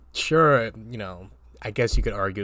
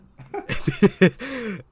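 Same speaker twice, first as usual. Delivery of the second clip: high laugh, louder; laughing fit; 13 semitones higher, 7 dB louder than usual. Real, not acted. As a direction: keep giggling, pitched up. Still laughing.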